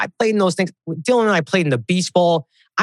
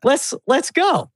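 mock eager; 9 semitones higher, slower than usual. Retold eager reply to Anthony.

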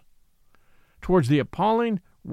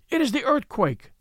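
high, mild mock scream of terror; terrified scream; 8 semitones higher than usual. A narrator's reading. Woman fleeing the church, a mild mock scream. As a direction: shriek it as the ground shakes. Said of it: "screamed a woman's shrill accents in ghastly terror".

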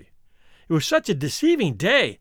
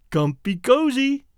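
slow, high-pitched, mocking question; ironic; 7 semitones higher than usual. A narrator's reading. Inner censor taunting the sleepy man, ironic. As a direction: needle him with drawn-out irony. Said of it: "asked his ironic censor".

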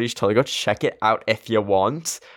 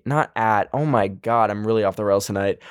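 ordinary talk, nothing marked

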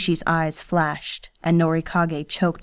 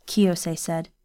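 ordinary delivery, nothing marked

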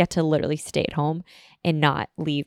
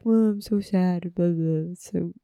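mock self-pity. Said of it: "throwing myself a pity party".

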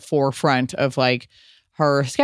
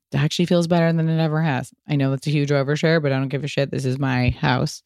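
normal talking voice

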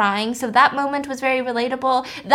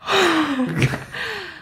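shocked gasp; big audible gasp; sharp gasp; 6 semitones higher than usual. Real, not acted. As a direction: suck in a startled breath. Reacting to bowling death question.

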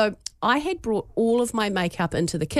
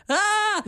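put-on toddler's pained cry; 11 semitones higher than usual. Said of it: "he's going".

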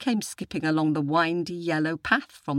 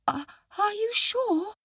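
put-on very high child voice; squeaky, unsure question; doubtful little boy. A narrator's reading. Boy questions the tiger's promise, high and doubtful. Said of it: "asked the boy".

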